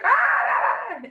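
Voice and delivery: sharp high mock yell; stranger's sudden yell. A stranger across the street yelling out of nowhere, loud mock shout. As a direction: shriek out a short Ah, high and loud. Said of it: "they're like".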